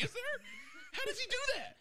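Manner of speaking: high amazed squeal. Retold or imitated. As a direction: marvel in disbelief. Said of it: "It's like".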